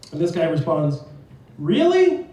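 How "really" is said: high, loud eager question; hopeful surprise. Acted. Texter offered a discount after a prank, hopeful surprise. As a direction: lift it high, eagerly taking the bait.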